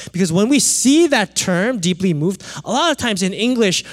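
usual speaking voice

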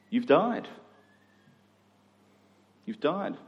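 usual speaking voice

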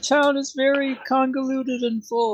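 extremely high, silly squeak; donkey-brained guard. Acted. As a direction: play a clueless guard in a squeaky voice. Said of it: "because I'm donkey brained".

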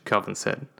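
ordinary delivery, nothing marked

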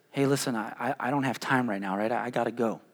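low, soft excuse; parent brushing off. Acted. Parent telling a child there's no time, low and quiet.

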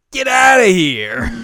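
cartoon guard shouting; mock yell. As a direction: screech him out of the room. Guy chasing artist away.

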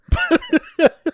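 high, loud laughing burst; sudden loud laugh; loud burst of laughter. Real, not acted. Laughing at his own morbid joke.

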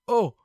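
high, sudden gasp of pain; re-enacted gasp and small "oh". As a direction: gasp as if the leg suddenly hurts.

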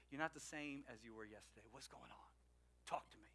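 soft, low caring question; gentle concern. Retold or imitated. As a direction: lean in and ask gently. Joseph gently asking, softer.

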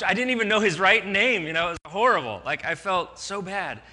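normal talking voice

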